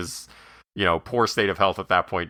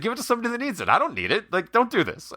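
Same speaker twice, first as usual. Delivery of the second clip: high, pitched-up mock yell; mock screaming argument; faster than usual. Larry David refusing the money, high mock yell. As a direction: push it away, voice kept high. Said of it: "they end up screaming back and forth".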